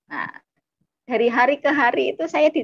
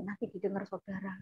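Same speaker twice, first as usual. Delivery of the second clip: very soft, quick, casual aside; relaxed demo; 14 dB quieter, faster than usual. Shown as a demonstration. Younger sibling warns of listening neighbours, soft and relaxed. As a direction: murmur it without any fuss. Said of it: "santai gitu".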